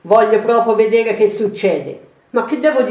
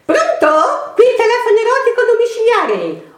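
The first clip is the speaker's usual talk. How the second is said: very high, fast switchboard voice; chirpy high phone operator; high sing-song operator; 13 semitones higher than usual. Shown as a demonstration. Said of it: "Vado, sono pronta".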